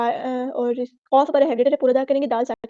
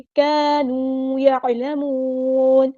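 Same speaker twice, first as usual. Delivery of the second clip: slow melodic chant, drawn out; Quran recitation; much slower than usual. Shown as a demonstration. Teacher models the verse for students, chanting. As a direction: intone the verse like a qari.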